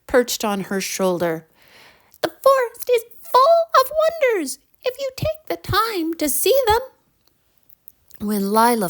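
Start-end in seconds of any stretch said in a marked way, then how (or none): 2.2-6.8 very high, tiny fairy voice. Acted. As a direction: speak like a tiny glowing fairy. Lead fairy speaks.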